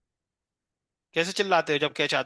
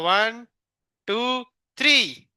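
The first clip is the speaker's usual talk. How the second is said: 1 drawn-out count; 5 semitones higher, much slower than usual. Real, not acted. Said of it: "हम three तक count करेंगे".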